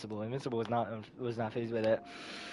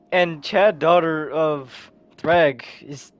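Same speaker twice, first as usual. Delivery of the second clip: ordinary delivery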